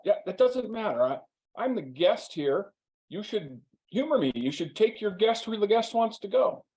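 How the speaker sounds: lightly voiced stubborn friend; insistent guest. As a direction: play the entitled guest demanding his way. Steve insisting on the lift.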